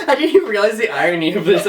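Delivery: normal talking voice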